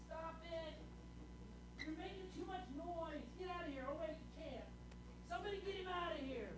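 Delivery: high, fast, annoyed scolding; irritated hushing. Acted. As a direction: shoo the noisy beggar away. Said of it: "the disciples go over".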